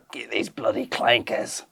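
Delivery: put-on slow, low New Zealand accent. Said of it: "a vaguely New Zealand accent being like".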